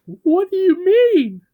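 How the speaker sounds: very high, slow squeak; squeaky truck voice. Acted. Truck asks Bob what he means, squeaky and slow. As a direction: stretch it out in a tiny high voice. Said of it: "one of the trucks said".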